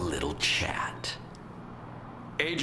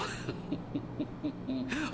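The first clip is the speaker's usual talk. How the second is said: villain chuckle; 9 semitones higher, 4 dB quieter than usual. Acted. Villain gloats.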